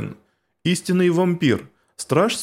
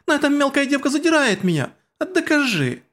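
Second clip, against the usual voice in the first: very high whiny protest; whiny squabbling; 11 semitones higher than usual. Acted. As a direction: whine back in a squeal.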